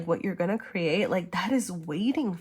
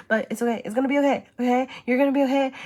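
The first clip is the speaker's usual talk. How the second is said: reassuring; 6 semitones higher, 4 dB louder, much faster than usual. Real, not acted. Reassuring the viewer after apologizing.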